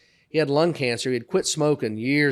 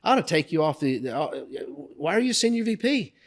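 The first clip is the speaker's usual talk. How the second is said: higher-pitched exasperated scolding; mock outrage climbing in pitch; retold outburst; 5 semitones higher than usual. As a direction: imitate a boss going off on someone. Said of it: "Charles goes off again".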